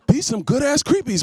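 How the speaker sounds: says "creepies" like creep-ees; "creepies" with a long ee, as creep-ees. Retold or imitated. Customer praising chef, says "creepies" for crepes.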